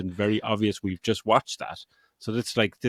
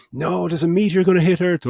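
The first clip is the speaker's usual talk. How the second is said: higher, louder hammed-up warning of doom; mock alarm; 8 semitones higher, 6 dB louder than usual. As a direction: shout a cheesy movie warning. Mock movie dialogue parody.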